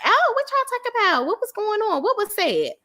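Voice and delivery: high-pitched, nosy mock curiosity; intrigued. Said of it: "[name] is intrigued".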